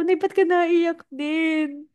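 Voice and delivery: put-on high, slow, childlike plea to her mother; imitating her younger self. Daughter asking her crying mother why she cries, high and slow. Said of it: "Tapos sabi ko".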